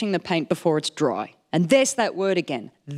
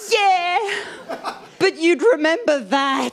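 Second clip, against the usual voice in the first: laughing disbelief; 9 semitones higher, much slower than usual. Real, not acted. Laughing at grandma's answer.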